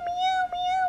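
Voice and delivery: high-pitched meow imitation; squeaky meowing at the pet; high cat meows.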